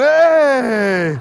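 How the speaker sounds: loud, high, sliding holler; drunk folks' cheer. Retold or imitated. Junior highers and drunk folks cheering together, rowdy cheer. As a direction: whoop it long and rowdy. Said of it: "junior hires and drunk folks going".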